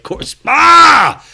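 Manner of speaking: high, loud mock yell; mock shout. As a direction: yell it out like Kyle. Mocking Kyle's outburst.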